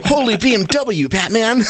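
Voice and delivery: high, gleeful mock Robin exclamation; campy superhero sidekick gasp; Robin catchphrase. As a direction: squeal it like the sidekick in the old Batman show.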